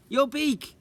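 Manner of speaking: high, loud squawking parrot. Acted. Parrot answers Mrs Jolly's pie joke, high squawk. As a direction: squawk the punchline.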